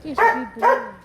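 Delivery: loud, gushing praise for the dog; loud happy praise; excited dog praise. Real, not acted. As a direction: cheer the dog on loudly.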